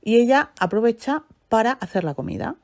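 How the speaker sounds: ordinary talk, nothing marked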